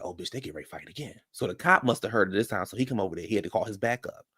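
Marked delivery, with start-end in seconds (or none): 0.0-1.2 hushed gossip aside. Retold or imitated. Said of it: "And we like".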